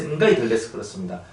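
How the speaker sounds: ordinary delivery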